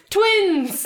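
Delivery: high, loud, triumphant shout; excited announcement. Acted. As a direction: announce the twins with a flourish. Announcing twins in story.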